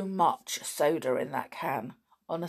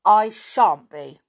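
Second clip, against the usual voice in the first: put-on sulky, louder child retort; rude; 2 semitones higher, 10 dB louder, slower than usual. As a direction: spit it back like a defiant girl. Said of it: "said Elizabeth in a rude voice".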